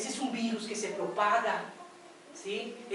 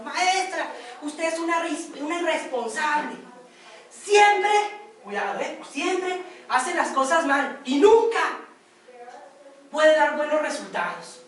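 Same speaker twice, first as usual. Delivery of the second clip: reproche fuerte y alto, cada vez más lento; directora regañando; 4 semitones higher, 6 dB louder, slower than usual. Acted. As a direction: humilla a la maestra frente al grupo. (directora regañando a la maestra con enojo)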